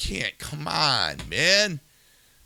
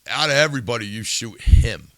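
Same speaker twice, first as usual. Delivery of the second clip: angry; 8 dB louder than usual. Real, not acted. Host addressing the shooter, angry and loud.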